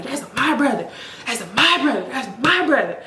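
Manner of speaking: "my" punched hard in each "That's my brother"; stress on MY. Retold or imitated. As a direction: repeat it insistently, hammering the word "my". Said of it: "putting the emphasis".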